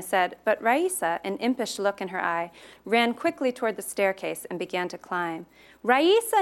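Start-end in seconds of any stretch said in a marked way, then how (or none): 5.8-6.4 high, loud call of a name; a girl shouting for her friend to stop. A narrator's reading. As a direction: yell after her friend, louder and higher. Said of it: "Nina shouted".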